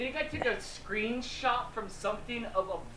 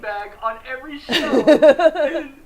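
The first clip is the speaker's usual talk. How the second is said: high, loud, helpless laughter in the words; cracking up at himself; laughing hard; 8 semitones higher, 14 dB louder than usual. Real, not acted.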